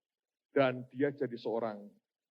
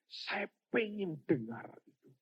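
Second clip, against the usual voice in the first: acted longing; 6 semitones higher, slower than usual. Acts man longing to hear God.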